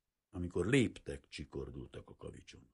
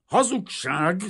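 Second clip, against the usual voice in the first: much higher, much louder, raging; furious shout; 13 semitones higher, 13 dB louder, slower than usual. A narrator's reading. The painter rages at the father's lie, furious shout. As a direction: spit it out in fury. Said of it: "tajtékozott a festő".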